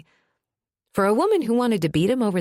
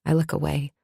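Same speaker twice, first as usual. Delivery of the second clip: ordinary delivery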